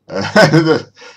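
loud, high burst of laughter; real high-pitched laugh. Real, not acted. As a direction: crack up loudly at your own joke. Cracking up at own impression.

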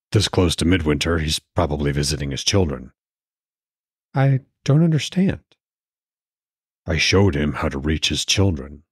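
put-on understated older husband voice; Odin. A narrator's reading.

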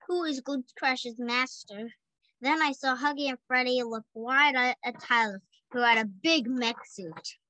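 plain reading voice

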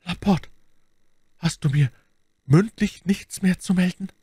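high, halting question full of disappointment; bitterly disappointed. A narrator's reading. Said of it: "als hätte er darin eine bittere Enttäuschung gefunden". (bitter enttäuscht fragend)

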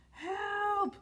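high, drawn-out storybook cry; cry for help. A narrator's reading. Trapped Tyro calls to the others, high cry for help. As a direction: call out for rescue in a high voice. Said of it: "cried Tyro".

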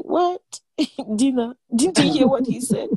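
incredulous, half-laughing outburst; mock disbelief. A wife protests to God about cooking, in mock disbelief. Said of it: "And you're like".

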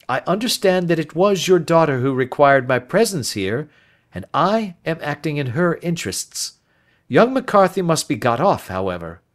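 firm but kind detective; a hint of a calm, resolute Holmes; Holmes reassuring.

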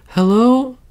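high, loud call into the woods; high child calling hello. Acted. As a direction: call out nervously into the dark forest. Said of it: "called the child".

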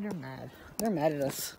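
normal talking voice

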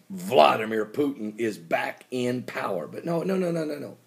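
ordinary talk, nothing marked

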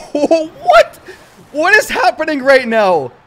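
high, loud laughing what; amazed disbelief. Real, not acted. Streamer amazed at his win, loud and high. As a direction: shout your amazement.

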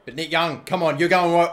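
ordinary delivery

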